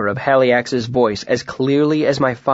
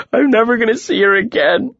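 much higher and louder, choked grief; 11 semitones higher, 4 dB louder than usual. A narrator's reading. Boy breaking down over his mother, choked out. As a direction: force it out through tears. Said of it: "I choked out".

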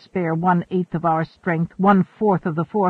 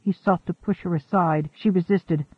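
plain reading voice

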